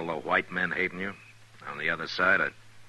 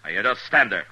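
loud, higher barked command; sharp order; 6 semitones higher, 9 dB louder than usual. Acted. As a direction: snap the order so he drops it now. Marshal orders outlaw to disarm.

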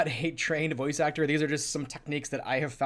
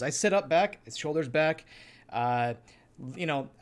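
ordinary talk, nothing marked

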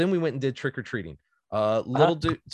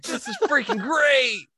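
put-on excited kid; 13 semitones higher, 3 dB louder than usual. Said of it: "as a kid would I have".

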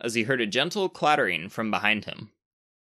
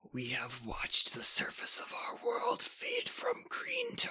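slow rasping whisper of an ancient creature; ancient dusty rasp; 10 dB quieter, slower than usual. Acted. Ancient creature warning the boy, slow rasping whisper. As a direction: breathe it out like dust from a tomb. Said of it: "it rasped in a voice as ancient and dusty".

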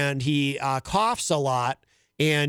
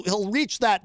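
ordinary delivery

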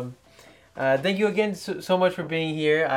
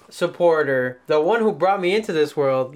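normal talking voice

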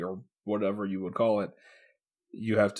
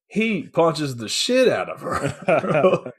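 laughing; 5 semitones higher, much slower than usual. Real, not acted. Laughing while retelling the fight.

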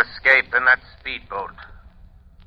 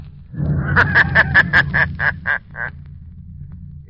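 sinister laugh; 6 semitones higher than usual. Acted. The Shadow gloats to the police over the explosion, sinister laugh. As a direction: laugh like a triumphant unseen avenger.

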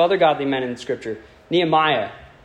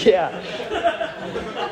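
high, laughing yeah; laughing mock reply; 7 semitones higher than usual. Preacher imitating his buff friend answering, laughing. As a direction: toss it off through a laugh.

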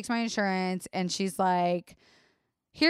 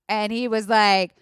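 ordinary talk, nothing marked

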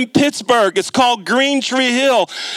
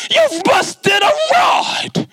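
repeated verdict; 12 semitones higher, much slower than usual. Retold or imitated. Mechanic repeats the bad news to the clueless student, in a high, slow, shouted drawl. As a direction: hammer the bad news home, dragging it out. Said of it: "I go".

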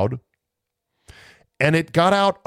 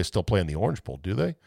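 normal talking voice

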